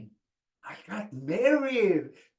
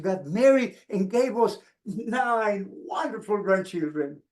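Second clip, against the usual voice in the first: ordinary delivery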